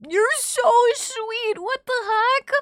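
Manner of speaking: high, drawn-out cooing; gushing. Real, not acted. Gushing over a viewer's gifts.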